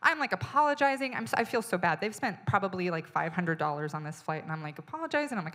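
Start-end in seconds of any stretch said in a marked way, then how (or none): none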